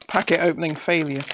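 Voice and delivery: ordinary delivery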